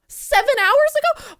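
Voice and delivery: high, loud, slow disbelieving cry; real shock at the early release. Real, not acted. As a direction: shriek the question in disbelief. Finds song released early.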